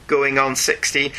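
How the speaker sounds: usual speaking voice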